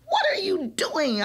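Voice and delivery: understated angry yell. A narrator's reading. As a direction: yell at the girl who hit a friend. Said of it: "I yell".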